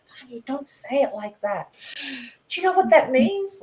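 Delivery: mock telling-off, rising into an alarmed question; imitated scolding. Relatives in Italy correcting her wrong word, imitated scolding. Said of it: "used to always end up in big trouble".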